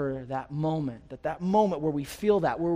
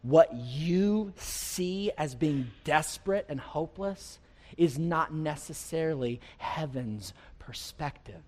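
ordinary talk, nothing marked

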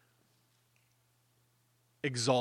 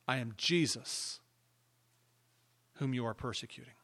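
Jesus's answer; 2 semitones lower, 6 dB quieter than usual. Retold or imitated. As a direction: answer quietly and gravely. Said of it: "the answer".

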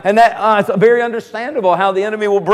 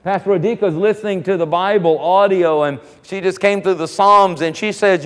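ordinary talk, nothing marked

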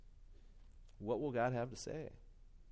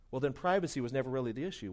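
normal talking voice

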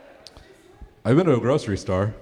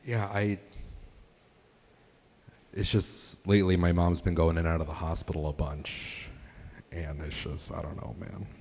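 sad; 2 semitones lower, 8 dB quieter than usual. Pretend. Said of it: "my impression of a bad day".

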